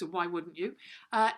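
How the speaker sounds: usual speaking voice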